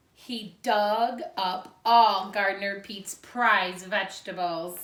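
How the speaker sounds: put-on lively read-aloud for young children; storybook reading. A narrator's reading. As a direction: read it like a picture book to second graders. Teacher reads picture book aloud.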